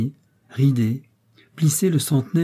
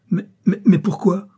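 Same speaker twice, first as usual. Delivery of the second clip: high, louder, panicked question; alarmed stammer; 8 semitones higher, 5 dB louder than usual. A narrator's reading. Professor about to be clubbed, alarmed stammer.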